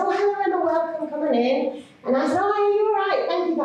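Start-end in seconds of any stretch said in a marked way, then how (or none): 0.0-1.8 high, bright, polished put-on welcome; posh greeting. Tutor greeting a pupil's mum at the door, high and polished. 2.4-3.6 high, bright, polished put-on welcome; posh greeting. Tutor greeting a pupil's mum at the door, high and polished.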